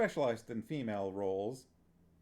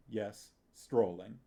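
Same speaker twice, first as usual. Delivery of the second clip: plain reading voice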